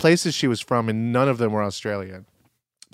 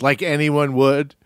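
ordinary talk, nothing marked

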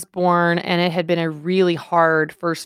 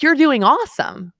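much higher, bright cheerleader lift on "You're doing awesome"; gushing compliment; 9 semitones higher than usual. Retold or imitated. Wife mimics a typical cheery compliment, pitched high and gushing. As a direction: toss off a bubbly compliment. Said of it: "That's a compliment".

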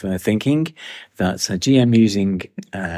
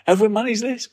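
excited; 13 semitones higher than usual. Real, not acted. Delighted reunion in the pub.

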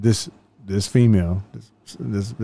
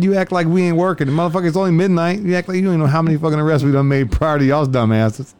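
mock scolding; 6 semitones higher than usual. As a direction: scold the prisoner with exasperation. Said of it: "I said".

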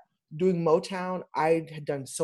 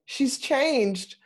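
rising higher toward she's changed; dismayed surprise; 5 semitones higher than usual. Retold or imitated. Returning to Motown, higher and higher dismay at her baby. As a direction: mourn the show like a changed child. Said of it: "I was like".

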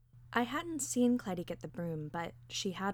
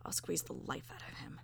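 low, soft, eerie rasp; hushed gravelly threat; gravelly and weird; 6 semitones lower, 9 dB quieter than usual. A narrator's reading. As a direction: growl it low and quiet, weirdly menacing. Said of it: "her voice gravelly and weird".

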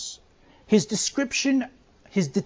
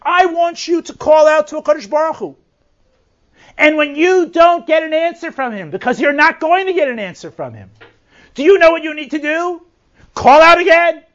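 higher and louder, rising to a call; urging exhortation; 6 semitones higher, 6 dB louder than usual. Retold or imitated. Yirmiyahu urging the residents of Jerusalem, loud and rousing. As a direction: rouse them to call out again. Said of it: "Yirmiyahu saying".

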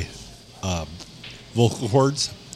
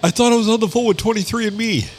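mock high excuse voice; 12 semitones higher than usual. Voicing Tucker's excuse.

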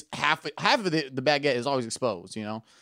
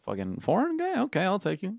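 impressed date; 7 semitones higher than usual. Acted. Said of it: "And she's like".

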